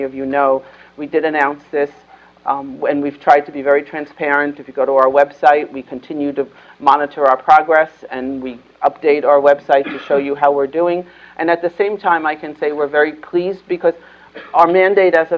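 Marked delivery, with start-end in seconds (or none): none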